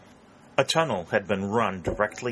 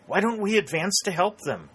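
higher, pressing plea; urgent cry; 11 semitones higher, 3 dB louder than usual. A narrator's reading. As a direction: urge them forward, voice raised high. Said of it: "cried Dick".

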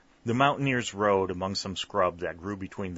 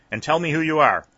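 loud, raised-pitch demand; sharp cry; 8 semitones higher, 10 dB louder than usual. A narrator's reading. Sergeant challenges the armed mountain band, sharp cry. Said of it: "he cried sharply".

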